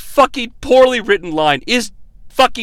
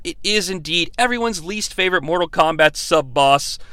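ordinary talk, nothing marked

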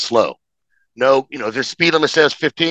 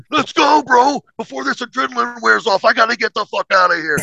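frantic urging; 10 semitones higher than usual. Retold or imitated. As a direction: hurry them off the mountain before the pain hits. Urging friends, injured, to leave.

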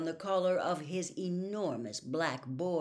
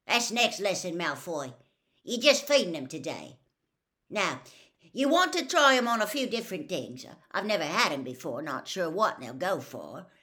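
louder gruff Hagrid; rough; 2 semitones higher, 7 dB louder than usual. A narrator's reading. Hagrid answers Malfoy, rough and loud. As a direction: brush the question off roughly. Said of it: "Then he said roughly".

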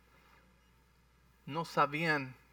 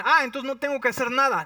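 mock careless objection; 8 semitones higher, 6 dB louder, faster than usual. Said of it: "Porque muchos dicen".

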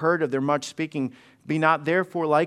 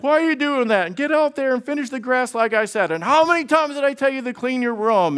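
higher, louder nagging mom voice; mock scolding mom; 6 semitones higher, 3 dB louder than usual. Pastor imitating his mom yelling at the kids, mock scolding. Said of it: "And Mom would be like".

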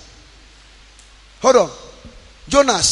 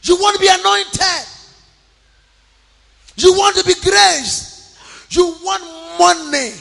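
preaching; 6 semitones higher, 4 dB louder than usual. Acted. Preaching to the congregation.

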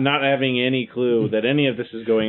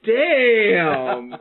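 higher, drawn-out appreciative damn; admiring exclamation; 4 semitones higher than usual. Acted. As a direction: let it out like you're ogling someone. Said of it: "you go".

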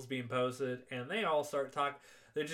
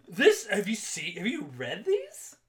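a champ playing the victim, pitched way up; indignant Apollo; 9 semitones higher, 6 dB louder than usual. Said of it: "He really does go".

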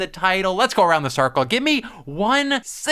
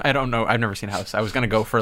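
ordinary delivery

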